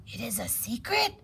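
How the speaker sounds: whispered question; hushed, breath-only snake voice; whispering snake. A narrator's reading. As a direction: whisper back conspiratorially. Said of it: "Snake whispered back".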